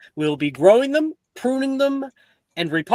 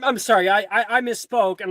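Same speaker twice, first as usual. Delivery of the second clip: normal talking voice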